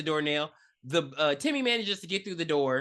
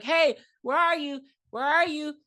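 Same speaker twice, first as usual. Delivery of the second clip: higher sing-song searching call; 8 semitones higher, 4 dB louder than usual. Retold or imitated. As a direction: call out for someone hiding. Woman in movie calls out searching.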